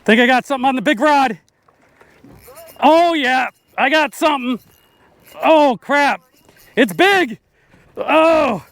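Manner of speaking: fighting a fish. Real, not acted. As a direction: fight the fish, heart racing.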